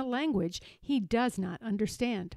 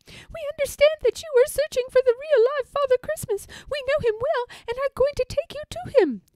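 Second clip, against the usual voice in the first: very high, loud chirpy bird voice; squeaky storybook sparrows; 6 dB louder, faster than usual. A narrator's reading. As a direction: chirp it out as little birds. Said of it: "They speak to him and say".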